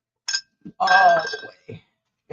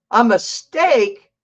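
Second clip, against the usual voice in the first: ordinary talk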